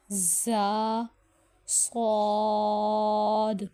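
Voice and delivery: زا and صاد with a hissing, whistling sibilant, صاد stretched very long; whistling letters. Shown as a demonstration. Tajweed teacher demonstrating the whistling letters, hissed slowly. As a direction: draw out the hiss of صاد like a whistle. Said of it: "ان کی آواز ہمارے پاس سیٹی کی طرح نکلتی ہے".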